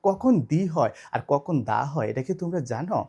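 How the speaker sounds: normal talking voice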